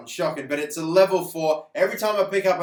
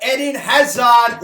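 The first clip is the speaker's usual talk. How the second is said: much louder, drawn-out hype at the big pull; excited reveal; 7 semitones higher, 13 dB louder, slower than usual. Real, not acted. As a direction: shout the name like a jackpot. Pack opening reveals star card.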